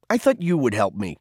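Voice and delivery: plain reading voice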